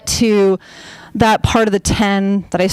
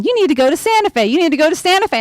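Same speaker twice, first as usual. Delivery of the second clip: lightly imitated insistent dad, higher and quick; quick higher-pitched pestering, mildly mocked; nagging dad; 5 semitones higher, much faster than usual. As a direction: repeat it like a nagging parent on the phone. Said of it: "my dad had been calling me".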